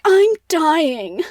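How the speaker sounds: milk it like a mock deathbed scene; so dramatic. Eric turning up at the Persian's house, so dramatic. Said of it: "like, so dramatic".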